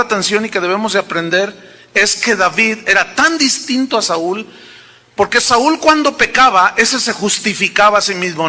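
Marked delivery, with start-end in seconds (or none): none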